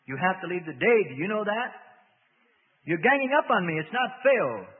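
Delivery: high, loud self-pitying demon; loud whining protest from the demon; self-pitying whine. A narrator's reading. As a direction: complain like a cornered child. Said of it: "A note of self-pity was coming in".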